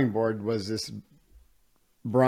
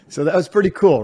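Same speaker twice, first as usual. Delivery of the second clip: proud and amused; 7 semitones higher, 8 dB louder than usual. Real, not acted. Telling the host about getting into class, gleeful. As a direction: pitch jumps up, louder, still laughing. Proud punchline after a laugh.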